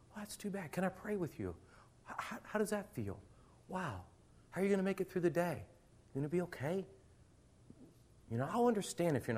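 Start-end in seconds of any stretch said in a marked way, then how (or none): 0.1-6.8 soft, caring teacher voice; gentle comfort. Retold or imitated. Caring teacher softly comforting an upset student. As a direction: comfort the child quietly.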